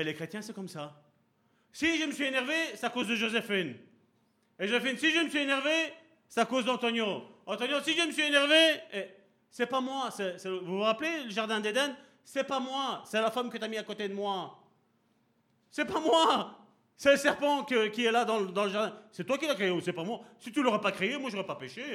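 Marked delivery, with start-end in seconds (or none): 1.7-10.1 raised, indignant mock protest; passing blame. One believer blaming the next, higher and rising indignation. 12.2-21.9 high, loud indignant protest; Adam's excuse. Retold or imitated. Adam blaming the woman and God in Eden, high indignant protest.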